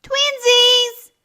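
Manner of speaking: high, loud excited announcement; announced. A narrator's reading. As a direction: show off the matching tutu.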